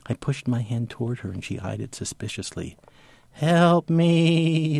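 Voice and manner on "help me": high, slow droning plea; eerie thrown voice begging for help; slow eerie drone. Acted. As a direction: drone it like a ghost from the palm. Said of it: "it said in a slow, eerie drone".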